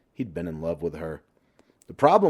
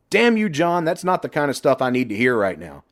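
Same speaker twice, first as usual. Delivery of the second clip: higher and louder angry outburst; shouted; 6 semitones higher, 6 dB louder than usual. Acted. Toby yells at John in the hangar, angry. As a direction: snap back at him in anger. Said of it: "Toby shouted".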